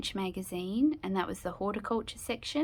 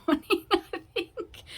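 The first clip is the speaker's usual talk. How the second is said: laughing; 11 semitones higher, 5 dB louder than usual. Real, not acted. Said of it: "I think I've had too much coffee".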